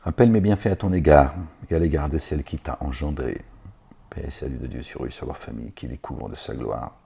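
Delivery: ordinary talk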